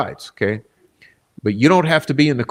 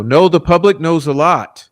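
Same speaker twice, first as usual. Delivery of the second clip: indignant rebuttal; 5 semitones higher, 4 dB louder than usual. Real, not acted. Rebutting juror's claim.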